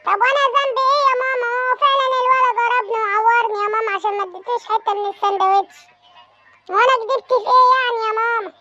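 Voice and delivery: put-on high, fast whiny little boy; a son protesting he did nothing wrong; child voice. Son pleading with his scolding mother, high whiny child voice.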